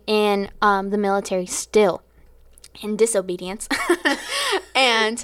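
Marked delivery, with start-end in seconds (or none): 3.7-4.7 high laugh. Real, not acted. Laughing about disobedience.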